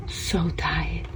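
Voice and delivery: breathy whispered pity. Real, not acted. Owner whispering beside her sleeping pet, hushed. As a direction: whisper so as not to wake him.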